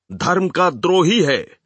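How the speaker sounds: angry crowd shout. A narrator's reading. Hecklers to the speaker on stage, shouting an accusation. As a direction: cry it out like a heckler. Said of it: "कई आदमियों ने चिल्लाकर कहा".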